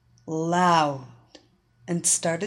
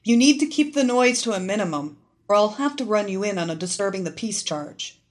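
fast, clipped-free official police talk; cop voice; 3 semitones higher, 4 dB louder, faster than usual. Acted. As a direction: rattle it off like a patrol cop. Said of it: "in her best copyese".

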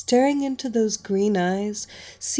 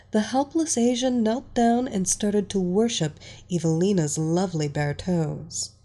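ordinary delivery, nothing marked

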